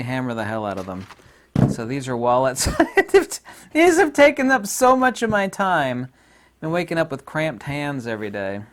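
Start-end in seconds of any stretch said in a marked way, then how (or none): 2.7-6.1 laughing. Real, not acted. Laughing about wallet making.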